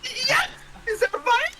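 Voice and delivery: very high squeaky excited voice; cartoon voice. Voicing a character in the game.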